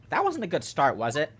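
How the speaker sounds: ordinary delivery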